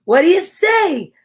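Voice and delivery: high, loud, eager question; child asking a pup. Acted. Bo asks Pal, high loud asking. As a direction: call it out brightly to a dog. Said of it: "Bo asks Pal".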